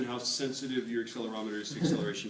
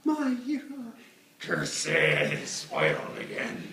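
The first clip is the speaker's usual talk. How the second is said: foiled villain; 14 semitones higher, 8 dB louder, much slower than usual. Pretend.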